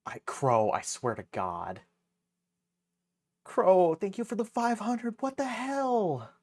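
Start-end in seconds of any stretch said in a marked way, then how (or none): 3.4-5.2 much higher, louder thanks; excited. Real, not acted. 5.2-6.3 much higher, slow stunned disbelief; shocked. Real, not acted. Shocked by big donation.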